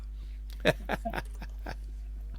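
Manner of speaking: soft, voiceless chuckle; laugh. Real, not acted. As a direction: laugh quietly at your own mix-up. Laughs at own cake mix-up.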